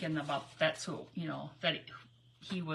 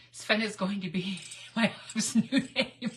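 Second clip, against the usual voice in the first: breaking into real laughter mid-sentence; laughing. Real, not acted. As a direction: start the joke, crack up before it ends. Laughing at her own joke.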